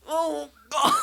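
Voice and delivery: high, strained cry of pain; mock agonised moan, drawn high; pained groan. Comedian relives his botched backflip, pained high groan. Acting out painful water landing.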